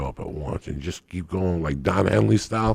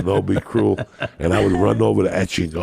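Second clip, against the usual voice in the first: ordinary talk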